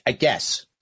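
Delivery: ordinary delivery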